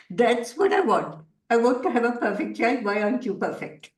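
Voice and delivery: lightly acted demanding parent. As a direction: voice the fussy parent, up and insistent. Parent voiced wanting perfect child.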